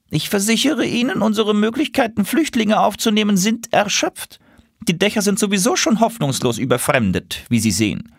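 officious pigeon. A narrator's reading. Pigeon turning the refugee away, smug and high.

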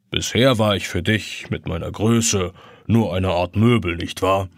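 put-on low, gentle old clock; gentle grandfather clock. A narrator's reading. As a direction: ancient clock gently teasing the boy. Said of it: "fragt die Standuhr sanft".

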